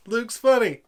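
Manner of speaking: mock the joke with loud fake praise; sarcastic. Real, not acted. Sarcastic verdict after laughing.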